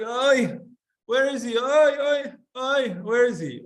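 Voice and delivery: high, loud oy-ing lament; hammed-up moaning oy; mock wailing mother.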